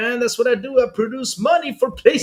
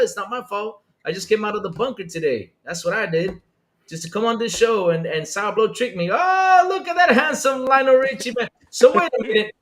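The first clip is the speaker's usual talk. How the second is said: ordinary talk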